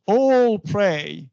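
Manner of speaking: ALL and PRAY both long and equally stressed; spondee stress. Shown as a demonstration. Teacher isolating the spondee for students, double stress. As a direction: lean heavily on ALL, then on PRAY. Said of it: "This is spondy".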